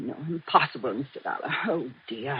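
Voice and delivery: quiet fretful insistence; hushed denial. Acted. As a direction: protest under her breath. Housekeeper denies, distressed.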